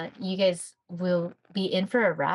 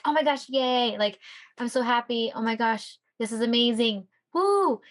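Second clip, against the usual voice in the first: giddy excitement; 3 semitones higher than usual. Retold or imitated. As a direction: gush like you just got accepted. Mimics excited newly accepted student.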